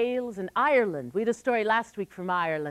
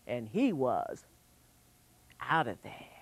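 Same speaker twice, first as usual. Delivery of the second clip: hushed ending; 9 semitones lower, 7 dB quieter than usual. A narrator's reading. Narrator drops voice.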